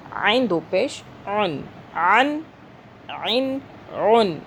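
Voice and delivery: عین and عن squeezed from the throat; throaty ain. Shown as a demonstration. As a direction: press each vowel of عن out of the throat. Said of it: "حلق سے عین کی آواز کو نکالنا ہے". (عین حلق سے)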